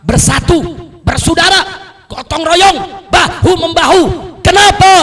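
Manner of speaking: loud rallying calls, pitched high; fiery preaching. Real, not acted. Preacher urges the congregation to stand together, fiery shout. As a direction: fire off each item to rouse the crowd.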